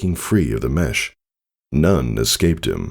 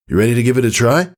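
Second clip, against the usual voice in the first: eager invite; 7 semitones higher, much faster than usual. A narrator's reading. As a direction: rush it out with eager energy. Father invites her.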